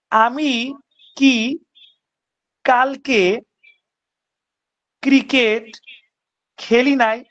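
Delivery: drawn out with long pauses between words; slow, word by word. Shown as a demonstration. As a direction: lay each word down slowly, one at a time. Teacher says question slowly, word by word. (ধীরে ধীরে, প্রতিটি শব্দ আলাদা করে)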